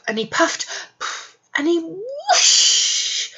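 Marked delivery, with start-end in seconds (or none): 1.6-3.3 slow high whoosh; whooshing blow. Acted. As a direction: blow it out long. Wolf blows house down.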